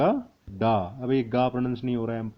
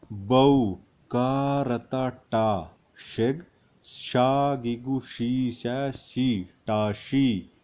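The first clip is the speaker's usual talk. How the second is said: very slow, syllable by syllable; slow spelling out; 3 dB louder, much slower than usual. Shown as a demonstration. Teacher repeating the spelling slowly for students. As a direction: lay out every syllable slowly. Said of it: "एक बार फिर से आपको बता देता हूँ".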